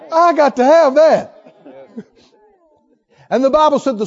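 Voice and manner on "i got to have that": higher, louder, laughing trout; laughing mock trout craving. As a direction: play the eager fish with a laugh. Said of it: "trout looks at that and goes".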